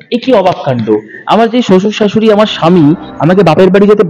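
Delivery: plain reading voice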